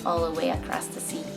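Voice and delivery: ordinary delivery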